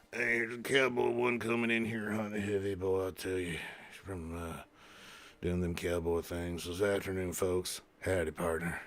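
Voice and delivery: low, soft cowboy voice. Acted. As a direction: read it like an old Western cowhand. Reads Cowboy One's message as cowboy.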